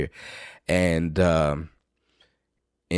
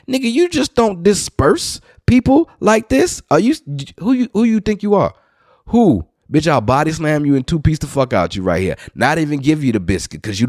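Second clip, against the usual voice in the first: much higher, louder tirade; storyteller ranting at the man who dispersed everyone; indignant rant; 8 semitones higher, 3 dB louder than usual. Retold or imitated. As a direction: tear into him, all worked up.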